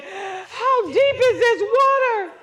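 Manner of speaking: high, loud, slow crying plea; a panicked swimmer asking the guide, high and crying. Retold or imitated. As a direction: beg in rising panic, almost crying. Panicked question in the water.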